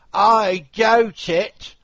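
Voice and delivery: high, loud and slow gloomy carpenter. Acted. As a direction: drag it out as the carpenter. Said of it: "said the carpenter".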